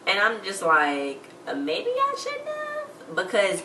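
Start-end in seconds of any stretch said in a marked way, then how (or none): 1.5-3.1 high worried voice. Retold or imitated. Her own worried reaction to scene.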